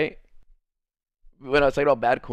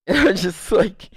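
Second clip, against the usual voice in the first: laughing; 4 semitones higher, 4 dB louder than usual. Real, not acted. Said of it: "so I started laughing".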